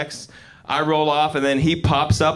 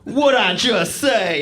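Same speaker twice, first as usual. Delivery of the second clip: drawn-out, high and louder challenge; slow high demand; 7 semitones higher, 4 dB louder, much slower than usual. Retold or imitated. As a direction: stretch it slowly like a soul singer's call. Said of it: "he goes".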